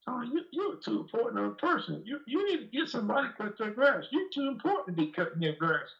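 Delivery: high, lightly voiced boss giving advice; imitating Mr Evans. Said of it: "He tell me".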